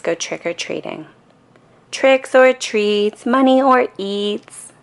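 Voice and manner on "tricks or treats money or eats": high, slow, loud chant; yelling. A narrator's reading. Kids at the first door, chanting loudly. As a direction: call it out at the door. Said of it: "they yell at the first door".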